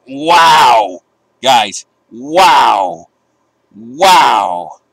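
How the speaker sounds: loud, drawn-out real amazement; stunned wow. Real, not acted. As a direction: shout your disbelief at the number. Oil price jumps ten percent.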